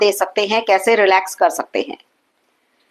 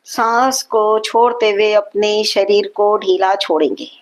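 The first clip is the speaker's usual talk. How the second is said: guided meditation. Acted. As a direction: guide them evenly through each long breath. Guides slow breathing and relaxation.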